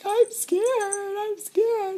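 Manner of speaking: very high, drawn-out mock wail; scared child. Girl left behind by brother, scared wail. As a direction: balk in a high, loud little-kid voice.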